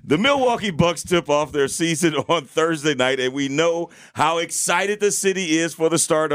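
upbeat radio ad read; promo read. Acted. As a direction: sell the broadcast like a station promo.

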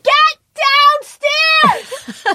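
high, drawn-out holler; full-on yell. Shown as a demonstration. Mom calling kids downstairs, full-on yell. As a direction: yell it through the house. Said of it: "I have a full-on yell".

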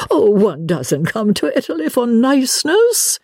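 high-pitched, theatrical retort; airy retort. Acted. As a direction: toss back a lofty, high-voiced reply. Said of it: "was the retort".

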